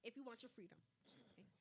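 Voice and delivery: very soft whisper; whispered aside. Real, not acted. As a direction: drop to a whisper, just an aside. Cheeky whispered aside to Britney.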